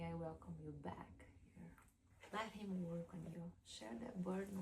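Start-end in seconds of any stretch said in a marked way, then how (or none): 0.0-1.7 breathy hushed whisper, low and soft; whispered prayer. Pretend. Viewer quietly welcoming Jesus back into their heart, whispering. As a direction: breathe the prayer as if alone with God.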